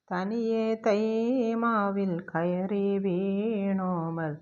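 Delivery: drawn-out, lilting reading of the verse; slow poem recitation. Shown as a demonstration. Teacher reciting the verse slowly to the class, lilting recitation. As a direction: stretch each word as if reciting poetry.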